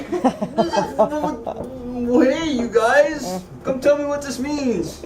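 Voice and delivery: a hammed-up, rattled king; king begging his wise men for help; freaked-out king. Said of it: "He's like".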